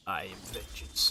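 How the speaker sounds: slow menacing Batman line; Kevin Conroy Batman. Retold or imitated. Gamer imitating Kevin Conroy's Batman, slow and low. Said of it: "Kevin Conroy is hard to imitate".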